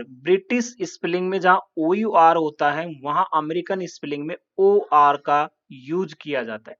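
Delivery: ordinary talk, nothing marked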